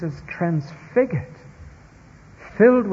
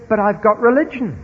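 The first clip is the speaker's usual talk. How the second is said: indignant lady; 8 semitones higher, 5 dB louder than usual. Retold or imitated. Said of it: "And she said".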